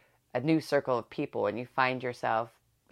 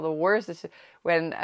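usual speaking voice

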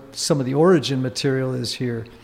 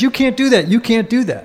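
mock angry; 7 semitones higher, 3 dB louder than usual. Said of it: "He comes into the room and says".

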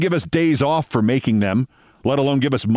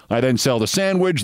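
usual speaking voice

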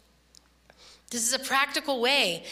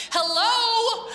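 laughing amazement; 11 semitones higher, 4 dB louder than usual. Retold or imitated. Amazed reaction in retold story.

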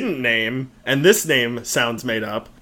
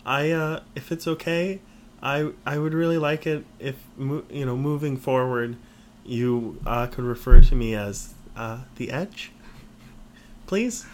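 hesitant request. Acted. Bandmate nervously asking the others for a new nickname, hesitant.

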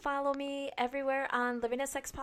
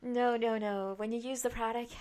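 ordinary talk, nothing marked

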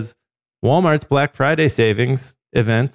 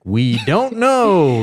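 slow, higher-pitched proclamation; drawn-out mock declaration; 5 semitones higher, slower than usual. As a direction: stretch each word out, playfully emphatic. Mock quote of show's news line.

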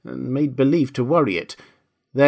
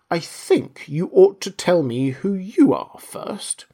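slow, high, dignified demand; very grave; 7 semitones higher, slower than usual. A narrator's reading. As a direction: draw yourself up and speak slowly, solemnly. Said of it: "said very gravely".

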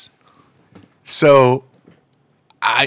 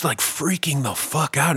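usual speaking voice

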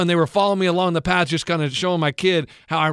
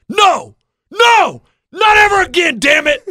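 put-on high, loud shouting old man; angry old man; 11 semitones higher, 8 dB louder, slower than usual. Coach imitating the old man screaming at him, high and loud. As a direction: yell it like a furious old neighbor. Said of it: "turns around, he goes".

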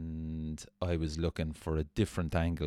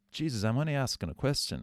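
fast, higher defensive protest; 7 semitones higher, faster than usual. Retold or imitated. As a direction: brush it off, fast and indignant. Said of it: "I just went".

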